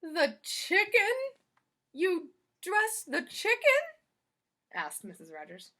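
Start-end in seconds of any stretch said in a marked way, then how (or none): 0.0-3.8 high, loud, slow disbelief; incredulous question. Acted. Missus Rogers asking Amelia Bedelia about the chicken, slow incredulous.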